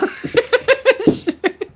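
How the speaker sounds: a real high giggle. Real, not acted. Reader cracking up at a viewer's comment, high giggle. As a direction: break into a high-pitched giggle.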